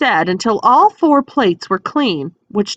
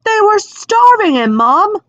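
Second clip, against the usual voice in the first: put-on high, loud boyish protest; George's protest; 12 semitones higher, 4 dB louder than usual. A narrator's reading. George protesting to his mother, high and loud. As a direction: jump in high and loud for Harry.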